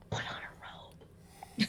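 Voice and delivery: soft breathy whisper, no voice. Pretend. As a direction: whisper it secretly. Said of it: "I'm gonna, like, whisper".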